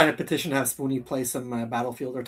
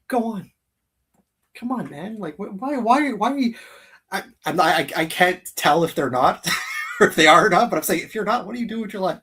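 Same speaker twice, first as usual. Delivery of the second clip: playful disbelief, higher pitched; mock exasperation; 5 semitones higher, 7 dB louder than usual. Host teasing viewers who haven't subscribed, mock exasperated. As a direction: tease the audience like they've lost their minds.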